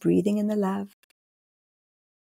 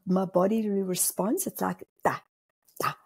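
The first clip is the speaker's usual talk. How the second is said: usual speaking voice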